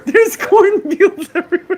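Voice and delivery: high, loud, stretched-out echo; mock proclamation. Co-host echoing the cornfields joke, high and hammed-up. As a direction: belt it out slowly in jest. Mockingly echoing the other speaker.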